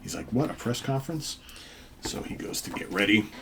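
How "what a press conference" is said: higher-pitched, rising disbelief; mildly surprised question. Acted. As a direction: question it, caught off guard. Revived man questions unexpected news.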